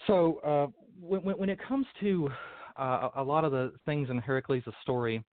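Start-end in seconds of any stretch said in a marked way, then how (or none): none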